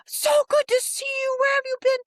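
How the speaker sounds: high, loud, gushing welcome; delighted guard greeting. Retold or imitated. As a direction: greet her like a guard thrilled to see an old friend. Officials greet a familiar visitor.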